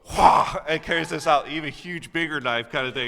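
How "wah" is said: loud, high mock yell of Wah; mock battle cry. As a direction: belt out a playful war cry to show off the knife. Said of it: "And then she goes".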